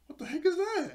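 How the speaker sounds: high, startled mock cry; freaked out. Retelling Yumi spotting the Marabuda, freaked-out high squeal. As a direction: squeal it like a spooked gamer. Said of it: "freak out a little bit".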